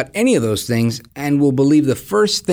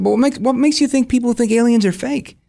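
puzzled question; 9 semitones higher than usual. Retold or imitated. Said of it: "And I said".

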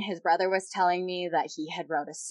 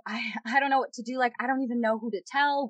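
higher, hurried, at a loss; retold helplessness; 4 semitones higher, faster than usual. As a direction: relive being flustered, words tumbling out fast. Retelling not knowing what to do.

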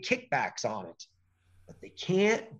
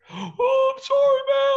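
very high, loud whiny apology; hammed-up groveling defendant; mock pitiful defendant; 9 dB louder, slower than usual. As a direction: squeak out a pathetic sorry. Mocking defendant's pitiful last words.

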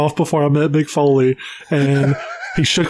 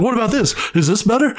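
mock Stewie voice; 7 semitones higher, faster than usual. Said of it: "He's like".

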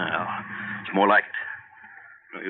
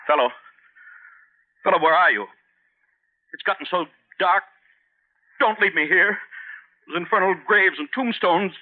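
terrified calling; 9 semitones higher than usual. Acted. Man abandoned among the graves calling for the stranger, terrified. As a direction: call out into the dark in growing terror. Left alone in dark cemetery.

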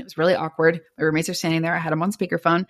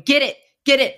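higher-pitched, urgent retold urging; urgent prodding; 8 semitones higher, 4 dB louder than usual. Roommate urging her to answer the ringing phone, urgent and high. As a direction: imitate a friend egging you on.

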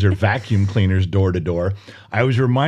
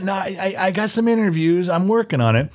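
sheepish son; 8 semitones higher than usual. Retold or imitated. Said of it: "You got a job yet?".

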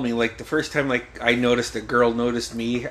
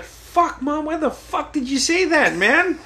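high-pitched retold whine of protest; exasperated complaint; 14 semitones higher than usual. Son confronting mom at home, exasperated complaint. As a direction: act out an aggrieved son, pitched up.

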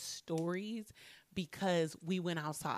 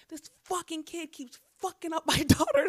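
angry mom voice; 8 semitones higher, 7 dB louder than usual. Retold or imitated. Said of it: "mom was like".

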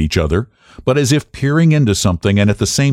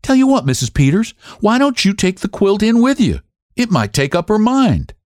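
higher, brighter offer of a happy idea; relieved exclaim; 14 semitones higher than usual. Acted. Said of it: "she exclaimed".

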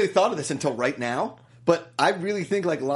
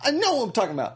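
higher-pitched put-on anger; mock irritation; 8 semitones higher, faster than usual. Telling him to stop stalling, mock irritated. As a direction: argue in a hammed-up high voice. Acted quarrel about the lady.

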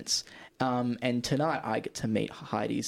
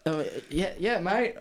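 put-on drunk fan; 6 semitones higher, slower than usual.

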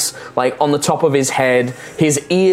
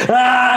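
high panicked shriek; goblin shriek; 9 semitones higher than usual. Acted. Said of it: "Here he's going".

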